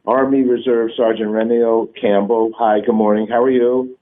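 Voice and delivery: usual speaking voice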